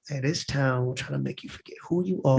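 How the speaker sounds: a touch of a polished Hollywood voice. Shown as a demonstration. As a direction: drop the accent and speak properly. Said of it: "I go back ... and be like".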